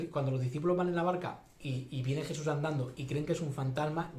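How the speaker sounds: ordinary delivery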